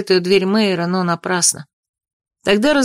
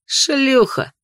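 scornful, higher-pitched jeer; contemptuous; 6 semitones higher than usual. A narrator's reading. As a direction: throw the insult out with contempt. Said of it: "презрительно кинул самый младший брат".

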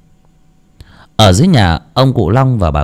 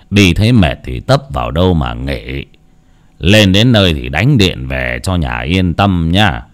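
heavy-voiced old father; 6 semitones lower than usual. A narrator's reading. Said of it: "nặng giọng mà dặn dò".

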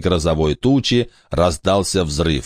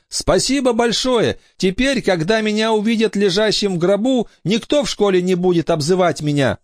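put-on very high pleading boy; 12 semitones higher than usual. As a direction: ask it as a young boy. Brandon thanks.

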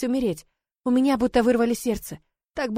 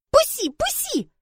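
put-on very high, slow baby-talk squeal; struggling child's cry; slower than usual. Sonya fighting her father's hold, high childish cry. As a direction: demand release as a little girl. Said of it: "кричать, бить отца кулаками".

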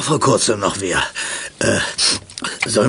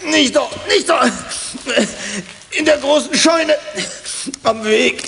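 high pleading; 11 semitones higher than usual. Acted. Pleading when caught.

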